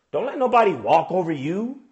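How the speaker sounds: usual speaking voice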